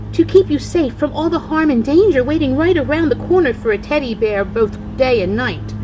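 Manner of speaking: ordinary delivery, nothing marked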